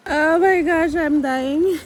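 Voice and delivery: loud, high, laughing panic; breathless excitement. Real, not acted. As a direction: gasp it out after a dunking. Just went under the water.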